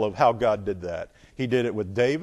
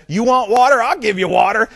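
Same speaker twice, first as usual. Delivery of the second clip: higher, louder sarcastic outburst; angry Moses; 8 semitones higher, 8 dB louder than usual. Retold or imitated. Moses mocking the complaining people, loud and high. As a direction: throw the offer back in their faces. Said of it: "Moses was so mad at the people".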